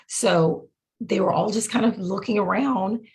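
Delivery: ordinary talk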